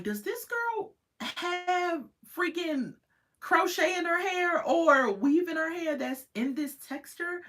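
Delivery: high, slow, incredulous wondering; a disbelieving high-pitched question; shocked disbelief. Retold or imitated. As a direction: wonder aloud, stunned, way up high. Shocked by sister's hair.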